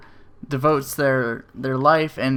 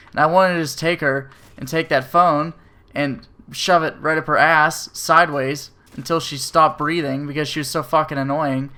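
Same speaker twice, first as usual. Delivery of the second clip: ordinary talk, nothing marked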